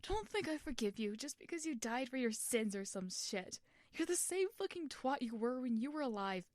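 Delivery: put-on furious boy berating Schlatt; contemptuous anger. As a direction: jab every word at him. Tommy angrily pointing at Schlatt.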